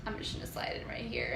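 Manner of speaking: quick, hushed whisper; imitated stealthy aside; sneaky whisper. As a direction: whisper it fast as if slipping in unnoticed. Imitates boy sneaking into line.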